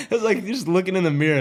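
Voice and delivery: high, loud and fast, tumbling out; hyped retelling of the chase; excited rush. Real, not acted. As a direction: race through it, still buzzing. Reliving cop chase excitement.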